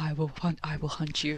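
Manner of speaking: low quiet action-hero threat; Liam Neeson tough voice. Retold or imitated. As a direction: menace it softly like Neeson. Joining Neeson impression.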